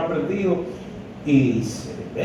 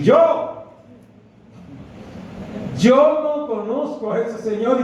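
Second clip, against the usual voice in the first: 1 panicked denial; 6 semitones higher than usual. Acted. Peter denies Jesus, voiced.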